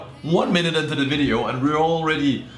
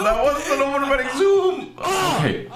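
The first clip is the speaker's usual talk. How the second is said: much higher shout of disbelief; indignant shout; 13 semitones higher than usual. Real, not acted. Reacting to the video's zoom, shouting in disbelief. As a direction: yell it at the screen.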